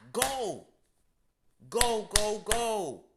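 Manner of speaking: a rising burst of shouted go's; shouted urging. Real, not acted. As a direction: yell it like a coach at the start line. Urging to seize opportunity.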